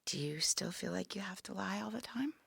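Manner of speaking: soft whispered probing; whispered question. Real, not acted. Woman asks her sister about lying, whispered. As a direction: ask it gently, barely voiced.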